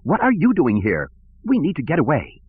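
high, urgent droid cry; C three P O. A narrator's reading. Droid urging his master to flee, high metallic cry. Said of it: "three P O cried".

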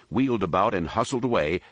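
ordinary delivery

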